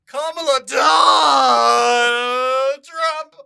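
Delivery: very long, high, loud wail; hammed-up stretched howl; mock electrocuted shout. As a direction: scream the name through a fake shock. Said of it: "we will at some point get buzzed".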